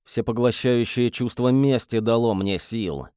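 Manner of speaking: usual speaking voice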